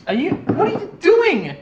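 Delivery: high, slow surprised "what are you doing". Real, not acted. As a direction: pitch way up in disbelief. Surprised at the cheers.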